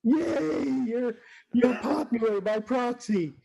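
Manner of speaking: very high squeaky cheer; mock cheer. Fans cheering the Brack voice actor, squeaky. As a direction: squeal it like a thrilled fan. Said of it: "They're like".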